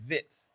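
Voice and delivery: "Wits" said as "Vits"; name pronounced with V. Shown as a demonstration. Commentator showing viewers the player's name, "Wits" said as "Vits". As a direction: say "Wits" with a V and a short i. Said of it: "the pronunciation of his name is".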